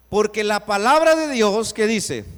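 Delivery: loud proclaimed opening of the verse; booming pulpit proclamation; preaching at full force. Acted. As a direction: call it out to the whole church.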